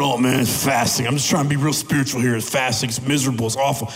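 low, put-on misery; pouty complaining. Someone showing off their fasting, mock-miserable pouting. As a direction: mope through a pout, hammed-up. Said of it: "with a pouty face going".

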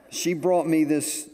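normal talking voice

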